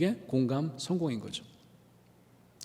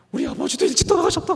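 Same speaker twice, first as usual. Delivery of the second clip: pitched well up, louder, retold pastor; pastor's confiding line; 13 semitones higher, 6 dB louder, faster than usual. Pastor tells the speaker his father died young, pitched high. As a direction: lift it high like a remembered pastor.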